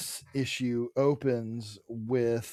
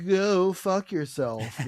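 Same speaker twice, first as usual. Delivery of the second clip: higher, drawn-out mock outrage; retold angry rebuff; 6 semitones higher, 3 dB louder, slower than usual. Wife rejecting her husband's apology, mock-indignant and drawn out. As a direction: stretch out the indignant retort.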